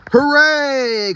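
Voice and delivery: high joyful hooray; cheering. Acted. Animals celebrate the stopped train, high cheer. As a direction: cheer like a happy crowd. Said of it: "cried the animals".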